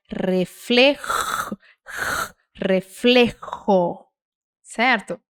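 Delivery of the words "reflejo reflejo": "Reflejo, reflejo" very slow, j rasped hard in the throat; forceful Spanish J. Shown as a demonstration. Teacher exaggerating the J for learners, "reflejo" with long forceful throat rasp. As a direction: hold the j of "reflejo" like an angry cat's hiss. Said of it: "você vai fazer isso com força".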